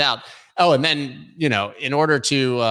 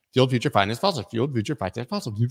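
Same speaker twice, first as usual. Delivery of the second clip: fast tongue twister; faster than usual. Shown as a demonstration. Host testing a headline as a tongue twister, fast. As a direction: race through the tongue twister, speeding up. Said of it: "Say that six times fast".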